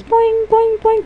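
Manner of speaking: high springy boings; bouncing cartoon sound. Little smiley circle bounces for joy, high boings. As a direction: sound out each bounce in a squeaky voice. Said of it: "these are our little circles".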